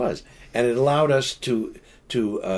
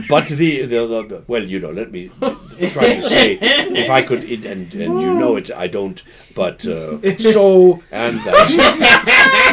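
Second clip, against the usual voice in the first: mock stammer building louder into laughter; stammering interviewee imitation; 4 semitones higher, 9 dB louder, slower than usual. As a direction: pile up false starts and fillers, hammed-up. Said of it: "like ers and uhs and buts and pauses".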